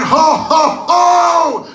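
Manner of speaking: booming high Santa ho ho ho; loud ho ho ho. Retold or imitated. Santa masks a crying kid with loud ho ho ho. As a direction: drown him out with hearty ho's. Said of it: "I start going".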